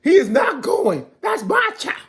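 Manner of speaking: high, loud protest; indignant cry; outraged mother. Retold or imitated. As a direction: claim the child as hers. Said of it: "Alpha was like".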